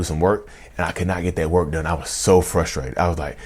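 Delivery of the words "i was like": ordinary delivery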